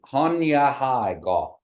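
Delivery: Hanya Hai Gaw said slowly and clearly; slow model phrase. Shown as a demonstration. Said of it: "You say".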